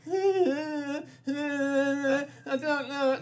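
high, drawn-out non-answer; neither yes nor no on the phone to Adam; undecided dithering. Retold or imitated.

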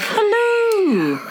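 high, hollow echoing call; toy echo microphone. Acted. Poem call, echo mic foley.

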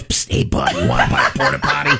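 low, fast whispered pitch; secretive raspy sales murmur. Retold or imitated. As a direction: sell it like a shady alley dealer. Shady alley dealer offers goods.

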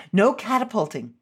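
ordinary talk

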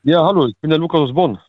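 thin telephone line sound; over the phone. Real, not acted. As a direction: caller heard through phone line. Anrufer in Radiosendung zugeschaltet. (wie am Telefon)

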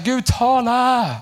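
high, drawn-out mock plea; imitated calling out to God. Pastor imitating believers calling on God, high drawn-out cry. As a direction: call upward, stretching each word. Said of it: "Tänk ofta vi ropar så här".

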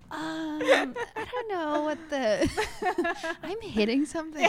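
high, slow, cracking up mid-line; laughing boyfriend quote. Retold or imitated. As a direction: play him awkwardly, laughing through it. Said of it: "he's like".

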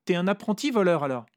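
high, loud teasing; Vera mocking. A narrator's reading. Vera teases the narrator as an apprentice thief, high and loud. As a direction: poke fun at him.

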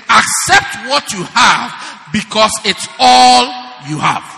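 slow, loud, stretching "all"; repeated emphasis. Acted. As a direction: say it again, leaning on "all". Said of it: "I say it again".

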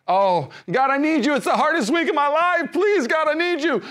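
high, loud, begging plea to God; desperate pleading prayer. Retold or imitated. Churchgoer crying out to God in crisis, high and pleading. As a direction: beg like it's the hardest week.

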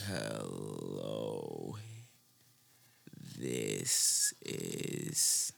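dragged out, very low and soft; slow motion. Shown as a demonstration. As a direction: stretch every word in slow motion. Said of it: "you guys talk in slow motion".

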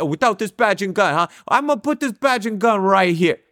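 high, put-on Italian New York accent; bad Italian New Yorker accent. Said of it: "a really bad Italian New Yorker accent".

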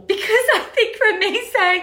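high, laughing; amused by the absurd complaint. Real, not acted. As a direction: talk through a laugh. Laugh heard.

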